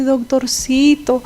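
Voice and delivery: slow coaxing little doctorcito; butter up the doctor; sweet-talking patient. Retold or imitated. Patient flattering the doctor, lightly voiced coaxing. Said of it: "que te dice".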